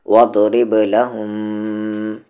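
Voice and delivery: slow recitation with the م of لَهُمْ held in the nose; nasal ghunnah. Shown as a demonstration. Teacher reciting slowly to show the ghunnah on the mim. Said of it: "آواز کو ناک میں رکھیں گے".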